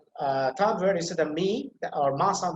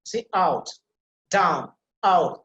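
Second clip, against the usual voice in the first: out, down, out, each punched alone; emphatic stress; 4 dB louder, much slower than usual. Shown as a demonstration. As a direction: isolate and hit out and down. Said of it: "that is emphasized".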